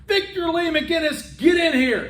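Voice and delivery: stern mom's high holler; tough mother calling. Retold or imitated. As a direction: imitate a mother yelling a full name through the house. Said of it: "I'd hear her go again".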